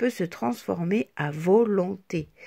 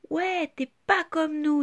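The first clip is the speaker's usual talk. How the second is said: higher mocking taunt; taunting child; 6 semitones higher than usual. A narrator's reading. Petit Losange excludes Petite Tache, higher taunt. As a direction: push her away with words. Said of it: "dit Petit Losange".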